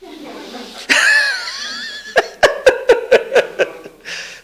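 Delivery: high shrieking burst of laughter. Real, not acted. Laughs at daughter's fear of beetles.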